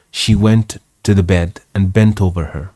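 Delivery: ordinary delivery, nothing marked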